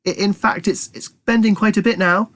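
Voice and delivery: high, slightly muffled; muffled rat. Acted. Trapped rat behind the dresser, muffled. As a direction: speak as if from behind wood. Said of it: "said the voice, which was slightly muffled".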